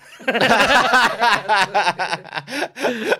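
high, loud rolling laugh; hearty real laughter. Real, not acted. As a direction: burst out laughing. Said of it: "long loud laugh".

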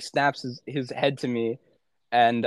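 ordinary talk, nothing marked

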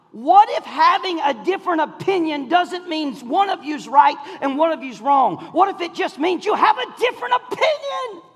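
high, loud, impassioned preacher; full-force preaching. Real, not acted. Preacher challenging couples on who is right, full-force preaching. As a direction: drive it home to the congregation at full voice.

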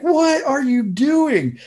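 slow, high, loud disbelief; imitated wife, loudly scandalized; appalled wife. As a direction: stretch it out in mock outrage. Voicing his appalled wife.

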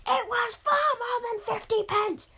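high, loud, outraged heckler; indignant listener shout. Pretend. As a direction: shout the correction from the crowd. Imagined listener shouting correction.

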